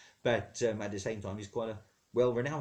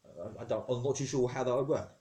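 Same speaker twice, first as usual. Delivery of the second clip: ordinary talk, nothing marked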